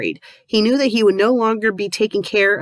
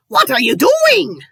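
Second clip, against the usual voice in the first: bad Irish accent; 12 semitones higher, 5 dB louder than usual. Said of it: "That was the worst".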